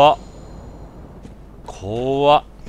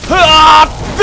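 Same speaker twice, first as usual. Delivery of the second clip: sudden loud high yell of alarm; startled yell; 12 semitones higher, 11 dB louder, much slower than usual. Real, not acted. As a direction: yelp as the enemy lunges. Startled by enemy attack. (驚いた叫び声)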